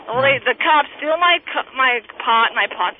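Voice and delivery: ordinary delivery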